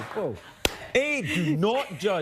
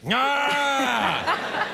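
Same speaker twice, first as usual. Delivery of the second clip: miasma creature cry; 10 semitones higher, 4 dB louder than usual. Pretend. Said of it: "they speak like this".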